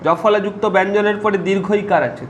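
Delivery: ordinary delivery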